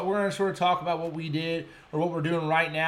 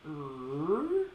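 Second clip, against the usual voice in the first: imitated reel noise; 5 dB quieter than usual. Imitating the line or drag noise.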